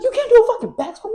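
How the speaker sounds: high, loud mock challenge; strength coach. Strength coach challenges the student, high and goading. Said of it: "he was like".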